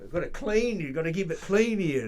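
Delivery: loud, high mock complaint; insistent objection. Old guy objecting at a cleaning pitch, mock-insistent. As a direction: hammer the point, imitating a fussy objector.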